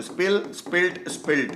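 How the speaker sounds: स्पिल्ट said clearly with a final t sound; demo pronunciation. Shown as a demonstration. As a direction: say स्पिल then स्पिल्ट, ending crisply on t. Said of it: "एक एल हटाते हैं और टी जोड़ते". (स्पिल्ट का साफ़ उच्चारण, आख़िर में टी)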